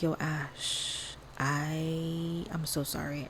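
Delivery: soft, sheepish apology; a friend calling to cancel, quiet and sheepish; apologetic friend. Retold or imitated. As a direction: say sorry quietly, almost under your breath. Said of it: "she was like".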